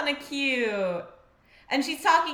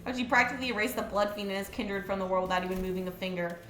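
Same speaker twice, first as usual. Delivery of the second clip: low, quick, even reading; soft story narration; reading game text aloud; 4 semitones lower, 5 dB quieter, faster than usual. A narrator's reading. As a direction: read it low and briskly, understated.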